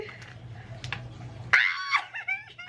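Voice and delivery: very high shrieking laugh; high-pitched ecstatic laugh; delighted squeal. Real, not acted. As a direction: squeal with excitement. Gushing over the books.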